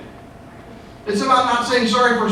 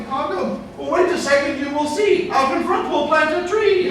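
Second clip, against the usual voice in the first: sing-song reply; 6 semitones higher than usual. Retold or imitated. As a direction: sweetly brush off the angry customer. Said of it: "And you'd be like".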